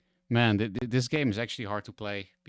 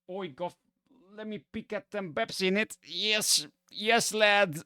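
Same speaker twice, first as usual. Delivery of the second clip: high, put-on British bruv accent with "in it"; mock bruv British accent; 10 semitones higher than usual. Dutch streamer jokingly imitates a British bruv accent. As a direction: ham up a cheeky British lad accent. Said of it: "bruv accent, the, the in it accent".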